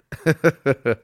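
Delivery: short run of breathy chuckles. Real, not acted. Streamer amused by a chat comment, chuckling.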